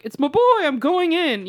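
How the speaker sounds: high, eager doggy declaration; happy dog. Acted. Chance following his boy indoors, eager. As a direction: bound in after your favorite person.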